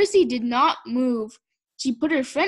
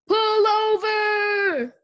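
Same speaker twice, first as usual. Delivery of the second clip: high, very slow, drawn-out shout; bullhorn shout; 9 semitones higher, 5 dB louder, much slower than usual. A narrator's reading. Officer orders the speeding car to stop, bullhorn shout. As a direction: stretch out the order as through a bullhorn. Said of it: "He shouted into his bullhorn".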